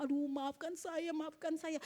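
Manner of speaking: soft, drawn-out tearful apology, lightly acted; imitasi tangisan. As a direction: mildly imitate someone crying for forgiveness. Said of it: "akhirnya dia nangis". (menirukan permintaan maaf sambil menangis)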